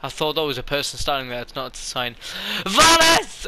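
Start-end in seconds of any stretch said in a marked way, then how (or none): 2.6-3.5 high, loud cry of delight; high-pitched excited greeting yell; excited shout. Real, not acted. Spots the painted Valis, cries out.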